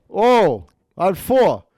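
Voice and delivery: high, loud crow of triumph; mock gambler's cheer. Gambler calling his winning roll, excited imitation.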